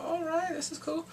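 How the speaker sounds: high, pleased exclamation; a delighted little cheer at the show; excited reaction. Real, not acted. Watching the drama, reacting with excitement.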